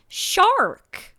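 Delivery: shark with a hard American R, high and loud; American accent. Retold or imitated. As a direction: hit a big American R in shark. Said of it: "very American accent".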